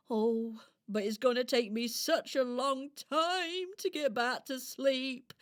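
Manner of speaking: fretting hedgehog character. Acted. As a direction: lament it as a sleepy storybook hedgehog. Hedgehog frets about sleep.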